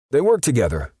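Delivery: high, loud nervous answer; frightened perp. A narrator's reading. Perp answering Steele in fear, high and loud. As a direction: blurt it out nervously.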